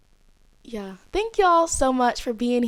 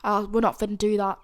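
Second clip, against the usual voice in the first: ordinary delivery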